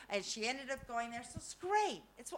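ordinary talk

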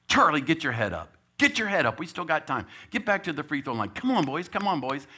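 high, loud coaching yell; sideline shout. Retold or imitated. Coach yelling at his players to keep going, high and loud. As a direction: fire up the boys from the bench.

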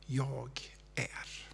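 breathy whisper of God's voice like wind; hushed sacred whisper. Acted. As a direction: whisper it, very soft, like wind passing. Jesus's words to the woman.

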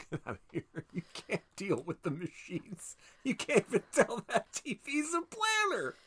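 thin, very high, soft squeak; laughing, climbing into a strained falsetto; mocking disbelief. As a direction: squeal it in mock incredulity. Mocking a film character's stupidity, laughing.

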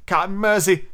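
high, whimpering plea; mildly acted trembling plea. A narrator's reading. Starkey begging the captain, whimpering. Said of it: "whimpered all of a tremble".

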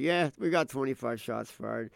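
casual, unexcited radio report; unfazed cop. Retold or imitated. Imitating Minneapolis cops used to gunfire, unfazed. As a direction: report it as pure routine. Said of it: "the Minneapolis cops are like".

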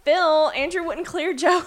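high, slow upset voice; whiny pissed Daisy. Retold or imitated. She's like, Daisy angry.

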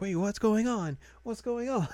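high-pitched mock confusion; Yui confused. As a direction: imitate a bewildered girl way up high. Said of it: "and Yui's like".

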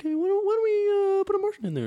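silly high-pitched producer impression; mock George Miller. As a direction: pipe up in a tiny pleading falsetto. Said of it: "He's like".

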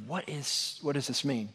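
bewildered hush. Retold or imitated. Shaken Peter, bewildered.

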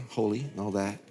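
softly mocking his holy act; mock pious. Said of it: "I acted all pastoral".

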